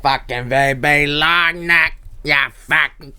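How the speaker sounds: slow, exaggerated Australian accent; imitating Aussie accent. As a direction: parrot the Aussie bloke's accent. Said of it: "imitating the Aussie clip".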